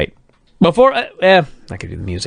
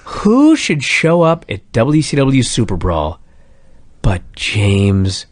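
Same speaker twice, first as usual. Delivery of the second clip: slow dramatic buildup; much slower than usual. Acted. Building up a big reveal.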